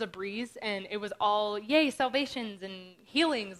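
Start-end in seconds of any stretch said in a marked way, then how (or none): 1.6-3.6 slow, louder sarcastic yay; mock cheer. Mocking the idea that outreach was all good news, with mock cheer. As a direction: send up the rosy version of outreach.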